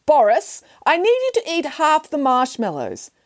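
high call to Boris. Acted. Nanny Piggins calling down to Boris, high. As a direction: call down to the bear. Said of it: "called out to Boris".

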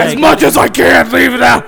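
blasting, very loud retort; loud shout. Real, not acted. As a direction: yell it over everyone. Player shouts reply.

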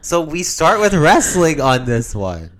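exasperated. Real, not acted. Exasperated argument.

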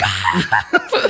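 high, loud squeal with laughter; gleeful high shriek; excited scream. Retold or imitated. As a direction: re-enact a delighted fan scream. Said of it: "I was like".

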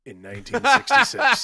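high, loud words through real laughter; breaking into laughter. Real, not acted. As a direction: crack up while finishing your own joke. Cracking up at own joke.

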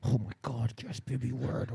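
breathy, hushed bedroom moan. Pretend. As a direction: moan it softly, like mid-sex. Acted moaning during pretend sex.

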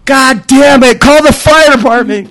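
high, desperate shout; panicked yell. Retold or imitated. Man losing his hose battle yelling for the fire department, high desperate shout. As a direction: yell it at the top of your lungs. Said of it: "I'm like".

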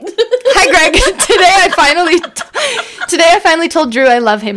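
racing through it between laughs; laughing rush. Acted. Danny telling fans he confessed, giggling rush.

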